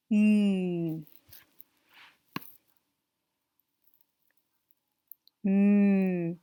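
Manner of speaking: held humming Mm, loud and long; drawn-out ending sound. Shown as a demonstration. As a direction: stretch the Mm out. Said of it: "is the ending sound".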